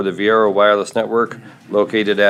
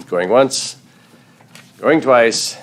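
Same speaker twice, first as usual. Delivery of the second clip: mild auctioneer call; lightly playing the auctioneer; 3 semitones higher, 4 dB louder, much slower than usual. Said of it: "Starting to sound like an auctioneer up here".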